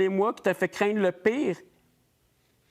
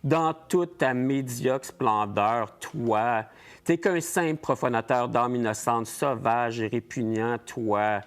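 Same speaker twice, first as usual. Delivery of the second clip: lower, slow, menacing contempt; cold contempt; 8 semitones lower, slower than usual. Acted. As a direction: face the monster with low disdain. Finally sees the demon. (mépris glacial, plus grave et lent)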